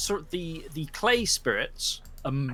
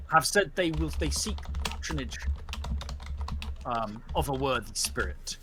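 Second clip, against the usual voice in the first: solemn judge's verdict; 4 dB quieter than usual. Acted. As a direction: pronounce judgement on the pack.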